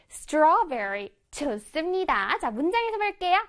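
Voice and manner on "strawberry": Strawberry slowly, without ng; correct English strawberry. Shown as a demonstration. Teacher repeating the correction for pupils, long aw in Strawberry. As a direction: stretch straw in Strawberry, no Korean vowels. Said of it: "스트롱베리 아니야".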